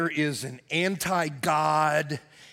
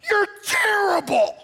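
high, loud, drawn-out yell; 7 dB louder than usual. Retold or imitated. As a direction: shout it at the screen in disgust. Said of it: "And I yelled out".

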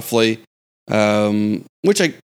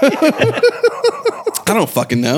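laughing; 9 semitones higher, much slower than usual. Real, not acted. Laughing admission, high and slow.

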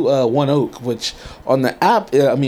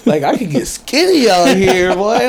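high, drawn-out amazement, getting louder; hyped self-quote; 6 semitones higher, 3 dB louder, slower than usual. Retold or imitated. Telling himself he could get skinny out here, mock-excited. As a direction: build from slow disbelief to high-pitched hype. Acts out his excitement over miles walked.

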